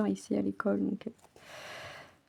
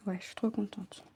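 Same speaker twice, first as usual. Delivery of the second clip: soft unvoiced whisper of delight; quiet happy whisper; 12 dB quieter than usual. Real, not acted. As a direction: breathe it to yourself, pleased. Happy with her embroidery.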